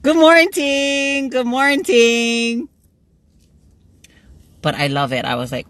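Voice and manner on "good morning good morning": high, loud singsong, very drawn out; long, held notes on each greeting; called-out greeting. Real, not acted. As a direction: sing out a cheery hello across the room. Calls greeting to someone passing.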